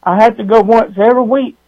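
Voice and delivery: ordinary talk, nothing marked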